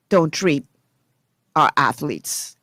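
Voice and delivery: ordinary talk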